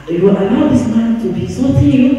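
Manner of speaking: rising, puzzled protest on someone else's behalf; incredulous question. Retold or imitated. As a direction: voice the priest's mild disbelief, pitch climbing. Astonished priest questions the bishop.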